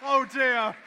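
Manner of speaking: slow, loud announcer call; champion announcement. Real, not acted. Crowning the champion.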